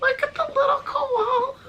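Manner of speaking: very high, cracking into laughing sob. Real, not acted. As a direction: voice breaks into laugh-cry over the koala. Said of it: "I don't know what just happened with my voice there".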